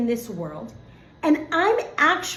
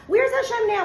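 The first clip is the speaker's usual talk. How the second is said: pitched-up, mock-distressed question; challenging doubters; 10 semitones higher, 3 dB louder than usual. Doubter upset over a sick kid, high mock-plaintive challenge. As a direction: plead for an answer like a put-upon doubter. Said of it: "and we're like".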